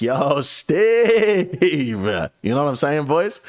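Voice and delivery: hollering across the club at her; drunk call-out. Retold or imitated. Drunk, calling out to Steve.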